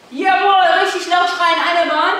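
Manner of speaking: high, loud shouted encouragement; cheering on. Real, not acted. Coach urging screaming children across the room, shouting. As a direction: drive them across the room.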